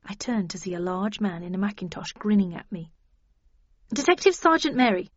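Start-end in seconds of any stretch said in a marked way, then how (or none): 3.9-5.1 higher-pitched, obedient introduction; eager to comply, voice lifted. A narrator's reading. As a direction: report dutifully to a superior. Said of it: "I told him obediently".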